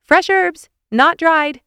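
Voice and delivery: high, loud reminder called after someone; calling out. A narrator's reading. Nicole reminds departing Carl, calling out. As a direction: call it across the house. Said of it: "she called".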